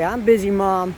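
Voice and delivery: lightly voiced dog, loud and laughing; voicing the dog. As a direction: speak for the dog, playfully.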